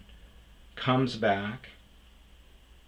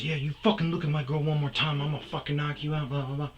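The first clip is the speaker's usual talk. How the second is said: fast, higher mock threat from a bully; hammed-up thug warning; tough guy threat; 7 semitones higher, faster than usual. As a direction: rattle off a cocky threat.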